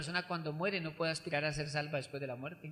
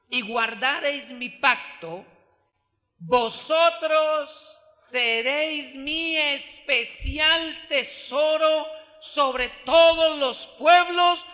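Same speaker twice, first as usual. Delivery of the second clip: slow, loud, ringing scripture reading; preaching scripture; 6 semitones higher, 12 dB louder, much slower than usual. Acted. Preacher proclaiming Exodus to the congregation, slow and much louder. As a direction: proclaim God's promise to the congregation.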